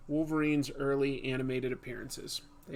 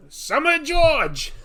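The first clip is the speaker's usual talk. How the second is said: much higher, louder slowed yell with a laugh; yelled George Costanza; 12 semitones higher, 13 dB louder, much slower than usual. Acted. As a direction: belt it out like Costanza. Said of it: "You just have to yell".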